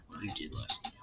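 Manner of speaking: soft unvoiced whisper; whispered line. Unvoiced trial read.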